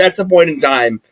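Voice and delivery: normal talking voice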